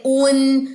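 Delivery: उन with rounded lips, N held; sustained उन, clean closed ending; rounded-lip demo. Shown as a demonstration. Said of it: "पेश के लिए होंठ गोल करें".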